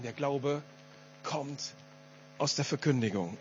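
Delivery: leise, tief, mit Pausen um „kommt“; sich selbst vorsagen. Shown as a demonstration. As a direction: sag es dir bedächtig vor, „kommt“ abgesetzt. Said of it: "wirklich selber zu lesen und es vorzusagen". (bedächtiges Vorsagen, „kommt“ einzeln gesetzt)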